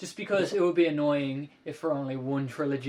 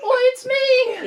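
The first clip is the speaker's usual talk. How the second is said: put-on much higher squeaky boyish voice; Tom Holland impression; 11 dB louder, much slower than usual. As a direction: squeak it out like an excited young hero. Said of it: "Gonna have to pretend I'm Tom Holland".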